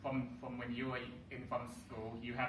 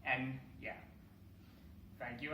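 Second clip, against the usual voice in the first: ordinary talk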